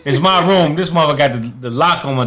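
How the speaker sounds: high-pitched, exasperated insistence; retold outraged complaint. Boxer locked out of his own room, exasperated. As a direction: protest in a jumped-up pitch.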